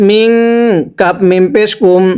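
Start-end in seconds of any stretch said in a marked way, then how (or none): 0.1-0.3 মিং with a long nasal hum; ghunnah. Shown as a demonstration. Teacher models noon before kaf, ghunnah. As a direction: hold the ং of মিং through the nose. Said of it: "গুন্নাহ করে পড়তে হয়".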